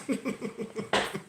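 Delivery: short, soft wheezy laugh. Real, not acted. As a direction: crack up quietly at your own joke. Cracks up at his story.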